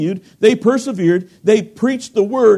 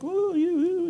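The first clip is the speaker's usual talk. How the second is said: higher, half-hearted imitated woo; mock congregation whoop; 12 semitones higher, 6 dB quieter, slower than usual. Preacher imitating the crowd's soulful jag, higher mock woo. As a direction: mimic a brief emotional burst.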